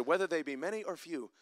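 ordinary delivery

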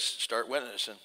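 low, soft, quiet command; Holy Spirit's prompting. Retold or imitated. Holy Spirit prompting the preacher while field dressing, low and soft. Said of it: "the Holy Spirit says".

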